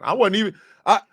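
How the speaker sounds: high, loud indignant outburst; furious rant. Real, not acted. Protesting to the crowd about unfair blame, furious. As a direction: let the anger spill out, loud and high.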